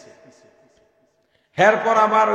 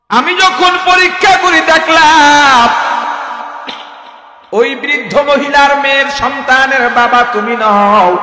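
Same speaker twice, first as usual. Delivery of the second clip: loud, high, slow booming doctor; doctor's loud call; 6 semitones higher, 11 dB louder, slower than usual. Acted. Doctor booming at the young man, loud and high. Preacher voices doctor shouting at youth.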